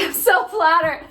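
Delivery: high, sing-song "I'm so flattered"; mock flattered. Mocking someone who pretends copying is a compliment, in fake delight. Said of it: "self-gaslight and be like".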